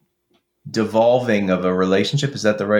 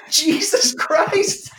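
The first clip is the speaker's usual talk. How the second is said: much higher, drawn out, slowly; stunned exclamation; much slower than usual. Real, not acted. Reacting to a heartbreaking lyric, stunned. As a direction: let it out as if floored.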